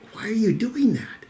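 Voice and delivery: mildly baffled, high-pitched asking; puzzled question. Acted. Puzzled adult questions roaring boy.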